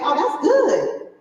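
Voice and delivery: laughing mock-content voice; mocking satisfied client. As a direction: play the customer who thinks that's plenty, a bit smug. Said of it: "they're like".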